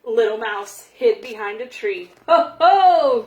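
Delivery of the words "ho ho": loud booming ho ho; a bear's loud laughing cry; hearty cry. A narrator's reading. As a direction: call out big and jolly like a bear. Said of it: "cried Bear".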